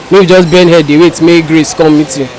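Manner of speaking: ordinary delivery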